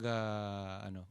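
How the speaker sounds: usual speaking voice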